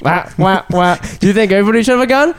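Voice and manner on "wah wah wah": muffled wah wah talk. Acted. Cold open, muffled crying voice.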